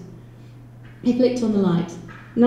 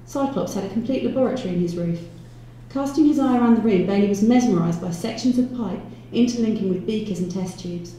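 usual speaking voice

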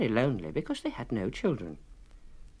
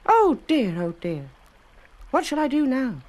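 high, anxious little cry; a tiny cookie stuck at the river; high fretful oh dear; worried; 13 semitones higher, 7 dB louder than usual. A narrator's reading. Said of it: "he said".

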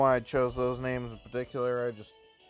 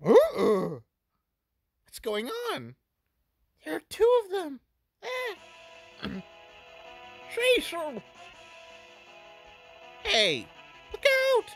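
put-on much higher, urgent young girl; 3 dB louder than usual. Voices a girl character.

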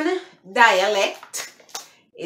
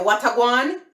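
higher Jamaican patois, rising at the end; 4 semitones higher, 3 dB louder than usual. Shown as a demonstration. Vlogger quoting what somebody would say, Jamaican patois. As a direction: toss it out as a Jamaican patois question. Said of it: "So if somebody say".

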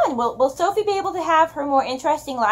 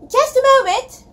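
much higher, louder scream over the crowd; 12 semitones higher, 6 dB louder than usual. Acted. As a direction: scream it back across a packed shop. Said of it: "Letty screamed back".

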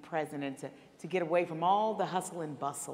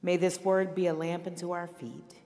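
pastoral prayer. Acted. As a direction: pray it over the congregation, phrase by phrase. Pastor leads closing prayer.

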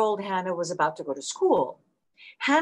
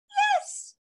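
very high squeaky toy monkey. A narrator's reading. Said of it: "she said in a squeaky voice".

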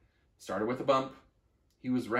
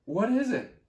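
much higher, louder question; eager question; 10 semitones higher, 5 dB louder than usual. Acted. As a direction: ask with excited curiosity. Acorn asking eagerly.

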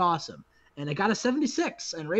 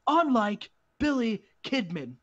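higher, emphatic microphone speech; wrestler's promo; 5 semitones higher than usual. Acted. Raven endorsing Mike Awesome on the microphone, emphatic promo. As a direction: declare it to the crowd in punched phrases.

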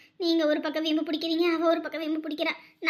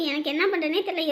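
usual speaking voice

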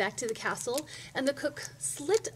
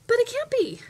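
very high, loud protest; indignant child; 13 semitones higher, 10 dB louder than usual. A narrator's reading. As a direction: object loudly to the unfair ending. Indignant child disputes the ending.

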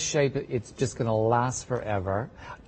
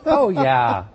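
laughing loudly; 3 semitones higher, 8 dB louder, much slower than usual. Real, not acted. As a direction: laugh through it. Laughing at the price joke.

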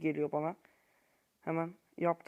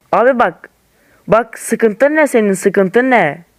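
much louder, slow scolding yell; angry shouting; 2 semitones higher, 17 dB louder, slower than usual. Real, not acted. Gamer scolding Rashford on screen, furious shout. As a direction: bawl out the player. Scolding player after misses.

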